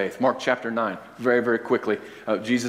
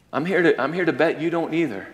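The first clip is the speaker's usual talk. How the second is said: ordinary delivery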